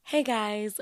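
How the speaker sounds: ordinary talk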